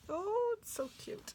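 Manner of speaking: high, gushing squeal of delight; excited squeal. Real, not acted. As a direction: squeal over something adorable. Gushing over cute stickers.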